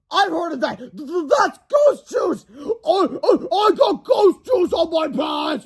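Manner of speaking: bully crying over ghost juice; wailing in tears. Acted. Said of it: "wailed as he burst into tears".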